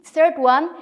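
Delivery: ordinary talk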